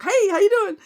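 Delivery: hammed-up eager coworker; overeager greeting. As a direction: leap on her with a squeaky eager hello. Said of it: "he would jump on me".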